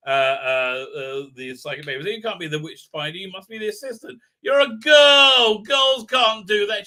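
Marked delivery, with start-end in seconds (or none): 2.1-6.9 mocking a sneering man who shouts the last words, louder and higher; mock chauvinist shout. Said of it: "he says".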